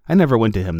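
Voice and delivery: usual speaking voice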